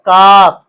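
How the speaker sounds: काफ called out loud and firm; letter name called out. Shown as a demonstration. Teacher to pupils, naming the letter काफ loudly. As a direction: announce काफ clearly to the class. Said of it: "क्या है".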